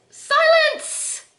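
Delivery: high, loud, drawn-out exclamation; exclaimed command. Pretend. Vlogger reads a blush name with an exclamation mark, mock commanding shout. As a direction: call it out like a mock royal order. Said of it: "It has an exclamation, that's why I said it".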